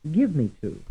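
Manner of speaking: "give" stressed in "give me to"; stress on first syllable. Shown as a demonstration. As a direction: hit "give", then fall away. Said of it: "stressed on the first syllable".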